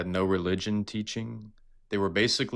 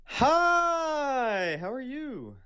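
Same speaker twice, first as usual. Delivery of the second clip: very high put-on greeting voice; 4 dB louder, much slower than usual. Caller startles the host on joining, very high put-on voice. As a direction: squeal the greeting in a silly high voice. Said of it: "the abrupt voice change startled me".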